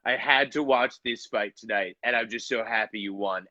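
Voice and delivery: a hint of Trump gushing over the win; Trump impression. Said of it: "Donald Trump calls this guy and is like".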